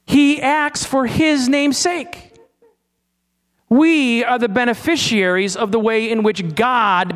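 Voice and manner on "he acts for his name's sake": very slow, each word weighted and high; slow emphatic proclamation. Real, not acted. Preacher stressing his main point to the congregation, slow and emphatic. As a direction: lay each word down deliberately, slowly.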